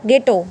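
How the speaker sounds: GETTO with a hard G and silent H; correct pronunciation. Shown as a demonstration. Teacher modelling गेटो for students, correct pronunciation. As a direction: say गेटो with no H sound. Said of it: "गेटो नहीं बोलेंगे इसको".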